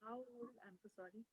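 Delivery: very faint, far from the mic; almost inaudible, distant voice; barely audible. Real, not acted. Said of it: "Can't hear you".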